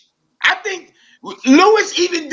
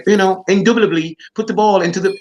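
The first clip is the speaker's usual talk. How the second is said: posh pundit parody; 5 semitones lower than usual. Retold or imitated. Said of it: "them posh talking dudes".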